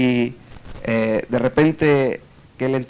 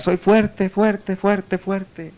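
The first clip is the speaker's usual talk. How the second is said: higher-pitched echo fading away; 3 semitones higher, much slower than usual. Retold or imitated. Cave answering the man's boast, fading echo. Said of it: "el eco a gritar".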